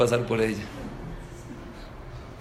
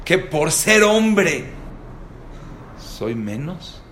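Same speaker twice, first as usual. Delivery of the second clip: slow, weighty sermon line; slow preacher emphasis; 4 semitones higher, much slower than usual. Real, not acted. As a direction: let each word land slowly. Sermon point delivered slowly.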